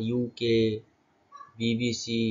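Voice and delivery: "ইউকে, বিবিসি" as separately named letters; each letter distinct in "ইউকে, বিবিসি". Shown as a demonstration. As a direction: spell out "ইউকে, বিবিসি" at a slow pace. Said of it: "এভাবে উচ্চারণ করেছিলাম".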